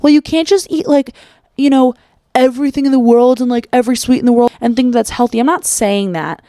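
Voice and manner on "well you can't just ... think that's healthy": mock critic. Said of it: "people can twist this and be like".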